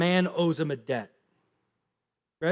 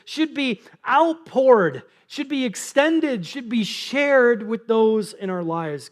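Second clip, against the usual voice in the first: preaching build; 4 semitones higher, slower than usual. Acted.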